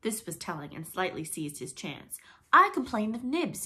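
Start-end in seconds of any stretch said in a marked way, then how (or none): 2.5-3.6 loud, high formal complaint; prompt cry. A narrator's reading. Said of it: "he cried promptly".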